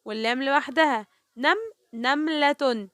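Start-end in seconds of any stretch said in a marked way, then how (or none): 1.4-2.9 first syllable of "نملة" alone, then the word slowly; slow teacher's split of "نملة". Shown as a demonstration. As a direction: sound out "نملة", first two letters joined. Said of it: "النون مع الميم واللام لوحدها".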